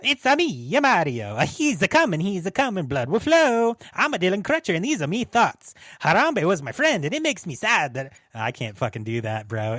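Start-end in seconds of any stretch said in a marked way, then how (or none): 0.0-5.7 loud, high hammed-up Italian accent; mock Italian accent. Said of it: "So I'll go Italian". 6.0-8.1 hammed-up Italian accent, a bit louder; mock Italian accent. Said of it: "So I'll go Italian".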